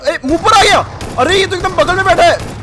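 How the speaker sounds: high, loud burst of surprise; alarmed excited shout. Real, not acted. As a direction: shout it like an enemy just popped up beside you. Enemy suddenly appears very close. (चौंककर ज़ोर से चिल्लाते हुए)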